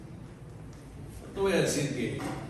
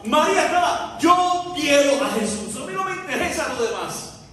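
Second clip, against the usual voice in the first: longing cry; 8 semitones higher, 6 dB louder than usual. Acted. María's longing voiced, shouted.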